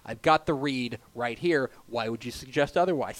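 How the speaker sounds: ordinary talk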